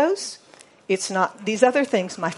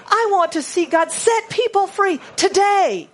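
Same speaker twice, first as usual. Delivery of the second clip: ringing preacher's cry; full-force preaching; 7 semitones higher than usual. Acted. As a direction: proclaim it to the whole room.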